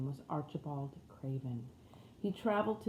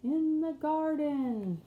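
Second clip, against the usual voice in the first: much higher and slow, sweet and flowing; like a golden flute; 9 semitones higher, 4 dB louder, much slower than usual. Acted. Wife's dream voice answering the husband, high, slow and sweet. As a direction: sing it out like a flute. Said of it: "like a sound from a golden flute".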